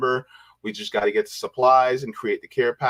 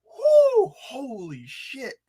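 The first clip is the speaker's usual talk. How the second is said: overwhelmed joy; 8 semitones higher than usual. Real, not acted. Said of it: "Yo, I'm getting emotional right now".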